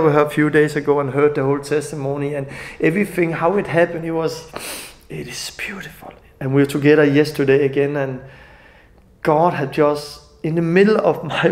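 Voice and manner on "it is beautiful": awed whisper. Real, not acted. Awed testimony, voice drops.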